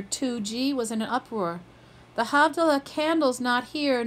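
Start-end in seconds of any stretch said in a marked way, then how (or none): 2.1-4.0 mild narrated yell. A narrator's reading. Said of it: "Nat yelled from the closet".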